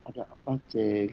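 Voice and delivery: very soft, low question; gentle approach. Retold or imitated. The Lord approaching the one who was shouting, hushed and low. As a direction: murmur it low, imitating a close-up inquiry. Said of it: "Tuhannya samperin".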